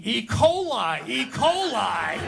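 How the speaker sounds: high mock cry of alarm; hammed-up scream, punched on each repeat; imitated screaming. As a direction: wail it out in pretend agony, pitch leaping up. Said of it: "He was actually screaming".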